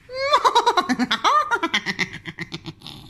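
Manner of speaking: high evil witch cackle. Pretend. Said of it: "my evil, evil witch laugh".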